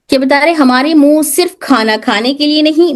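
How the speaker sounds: ordinary delivery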